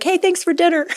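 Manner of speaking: high, chirpy thanks breaking into laughter; retold, perky and laughing; cheery mock greeting. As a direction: call out a too-happy thank-you, amused at yourself. Retold cheeky greeting to meal bringer.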